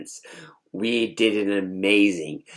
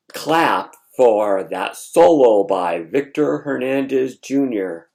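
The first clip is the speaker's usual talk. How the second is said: announcing brother; 3 semitones higher, 9 dB louder than usual. Retold or imitated. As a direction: call out thanks to the whole hall like an emcee. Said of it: "and he goes".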